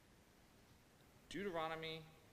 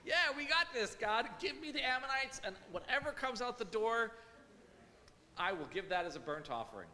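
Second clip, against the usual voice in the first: much higher, louder careless bravado; flippant boast; 9 semitones higher, 5 dB louder than usual. Retold or imitated. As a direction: toss it off like a cocky bargain. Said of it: "He was like".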